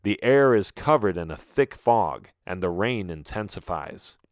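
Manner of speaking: usual speaking voice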